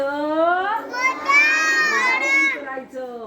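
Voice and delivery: drawn-out Bow down, sung high and very slow; Bow down as a slow, high, singing call; high drawn-out sing-song. Shown as a demonstration. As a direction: stretch the down high and long like a chant. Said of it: "काय करायचं?".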